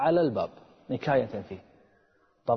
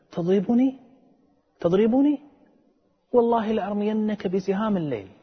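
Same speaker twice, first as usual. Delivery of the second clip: indignant protest; 8 semitones higher, 4 dB louder than usual. Retold or imitated. Slapped man confronts the vizier.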